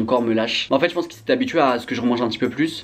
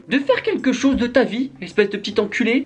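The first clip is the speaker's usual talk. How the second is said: mocking high voice; 11 semitones higher than usual. Self-insulting put-on voice.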